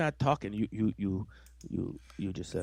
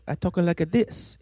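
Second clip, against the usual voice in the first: higher, put-on Italian accent; Italian mode; 7 semitones higher than usual. Shown as a demonstration. Showing his co-host how voices work, mock Italian accent. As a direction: talk in a cartoonish Italian lilt. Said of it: "if I go to Italian mode".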